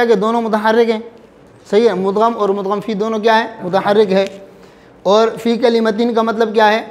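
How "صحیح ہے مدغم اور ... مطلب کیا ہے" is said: normal talking voice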